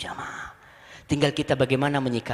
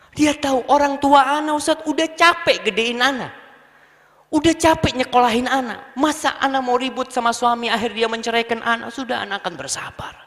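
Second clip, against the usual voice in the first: a hint of a wife's pleading voice, higher; patient wife; 8 semitones higher than usual. Wife tells the ustaz she will stay patient for her parents, higher.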